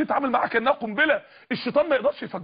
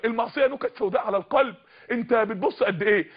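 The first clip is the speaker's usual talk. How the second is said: ordinary talk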